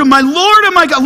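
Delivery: Thomas crying out, pitched high and loud. Retold or imitated. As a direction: cry it out like Thomas seeing the risen Jesus. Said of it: "He cries it out".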